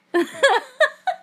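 high, disbelieving laugh; incredulous laugh. Real, not acted. Speaker scoffing at people judging strangers, incredulous laugh. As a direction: burst of high-pitched, exasperated laughter.

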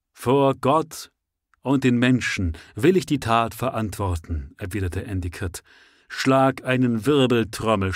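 rally the exiles with burning zeal; fiery preacher. Acted. Endicott answers defiantly.

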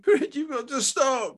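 slow, high-pitched begging; anguished plea. A narrator's reading. Wife begging her husband to stop, anguished. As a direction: beg him in distress. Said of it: "wringing her hands in anguish".

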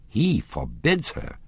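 slow, high-pitched incredulous question; incredulous echo. A narrator's reading. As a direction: repeat it back in slow disbelief. Raoul questions what he heard.

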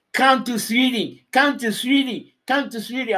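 loud, high insistent mother, come to Sweden; mother insisting. Retold or imitated. Man retelling how his partner's mother called loudly to Sweden. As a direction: shout it like a pushy mother calling you over. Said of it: "Mother was like".

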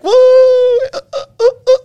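very high loud "woo", then broken yelps; high-pitched mock shrieks breaking up; imitated distant yelling. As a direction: mimic garbled screaming over a bad line. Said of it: "I can hear she's yelling".

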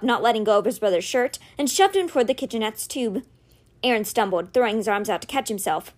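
ordinary delivery

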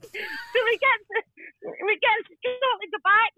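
high voice breaking into laughter; real laughter lifting the words high; laughing. Real, not acted. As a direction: struggle to tell it through giggles. Laughing as she tells it.

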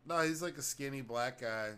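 ordinary talk

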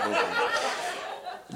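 unvoiced laughing don't do that; laughing whisper. Real, not acted. Preacher to congregation after a joke, laughing whisper. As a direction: breathe it with a chuckle.

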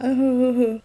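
high, wavering boo-hoo; put-on string of sobbed ohs; mock sobbing. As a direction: imitate a film heroine's crying. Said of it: "it's very sad, and she's like".

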